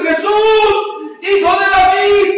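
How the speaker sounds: high, loud call to someone far off; shouting. Acted. Blind man calls Jesús along the road, shouting. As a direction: throw the cry out at full voice. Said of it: "él gritando por acá".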